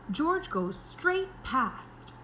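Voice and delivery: ordinary delivery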